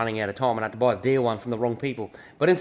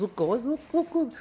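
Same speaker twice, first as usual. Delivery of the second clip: rising excitedly higher on each look; 12 semitones higher, 4 dB quieter than usual. Real, not acted. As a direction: call the viewers over, getting more thrilled. Showing off a fat hose.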